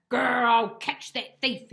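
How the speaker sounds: loud, growling dog threat; a cartoon dog growling a threat; growled threat. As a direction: growl it through your teeth. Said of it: "he growled".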